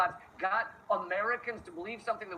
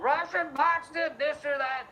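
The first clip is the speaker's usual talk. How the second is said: a lightly mocked frantic pundit, pitched higher; frothing pundit; 9 semitones higher than usual. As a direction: parody a breathless news host. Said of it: "breathlessly fucking frothing at the mouth".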